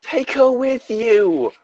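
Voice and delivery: very high, drawn-out redhead voice; fiery redhead. Acted. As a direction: plead high and slow as the redhead. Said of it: "a fiery redhead".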